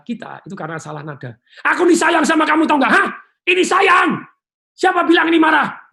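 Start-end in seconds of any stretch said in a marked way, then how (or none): 1.6-5.9 loud, high shouting that sounds furious; shouting love angrily. Retold or imitated. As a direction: yell affection like a quarrel. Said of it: "Ya itu marah". (berteriak marah padahal bilang sayang)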